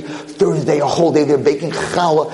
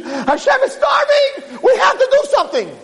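high-pitched, drawn-out cry of urgency; alarmed outcry. Acted. Peasant alarms his wife that God is starving, alarmed outcry. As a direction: cry out as if it's an emergency.

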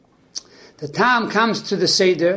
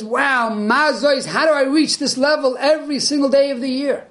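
higher, wonder-struck and eager; excited; 6 semitones higher than usual. Acted. Simpleton asking how to keep this high, excitedly. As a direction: ask like you can't believe your luck. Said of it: "he's on a big high and he's excited".

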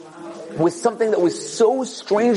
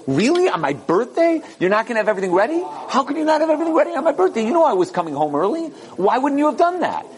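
indignant, incredulous questions, higher and fast in bursts; mock outrage, pitch climbing; angry husband; 8 semitones higher than usual. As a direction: act out a worked-up husband's rising outrage. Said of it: "He gets so angry".

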